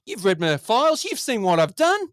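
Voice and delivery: acted film-character appeal; pleading his case. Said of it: "trying to plead his case".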